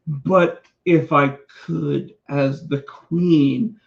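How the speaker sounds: slow, put-on British accent, half-mocking; sneering at the wrestler's fake British accent, slow mock British; mock British accent as Zelina Vega. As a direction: lampoon her queen's fake British accent, slowly. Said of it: "she's putting on that British accent".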